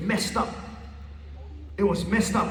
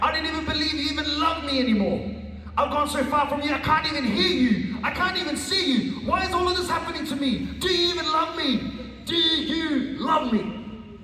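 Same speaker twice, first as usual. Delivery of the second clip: high, pleading cry without full volume; retold desperate cry; 6 semitones higher than usual. Fed-up student pleading with God, retold desperate yelling. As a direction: re-enact crying out to God, pitched up and strained. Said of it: "I was yelling, like, to the top of my lungs".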